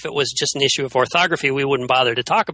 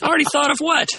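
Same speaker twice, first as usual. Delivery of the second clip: sharp high disbelief; surprised; 12 semitones higher than usual. Real, not acted. Friend hears his idea was taken, shocked. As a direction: caught off guard, voice jumps way up.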